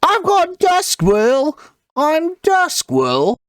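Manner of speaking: put-on very high, slow little elf kid; squeaky boy voice, stretched out; Duskwirl. Shown as a demonstration. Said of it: "to go back over them".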